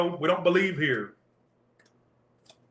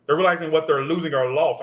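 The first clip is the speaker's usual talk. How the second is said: ordinary delivery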